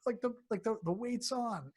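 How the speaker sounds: high, loud mock panic with a slow start; hammed-up body in a panic; alarmed body. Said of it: "It makes the body go".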